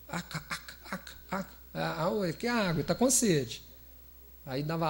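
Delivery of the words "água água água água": soft imitated baby babble, half laughing; a hint of a baby trying to say water; baby talk. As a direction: lightly babble like a toddler asking for water. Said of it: "Aí depois você aprendeu a falar".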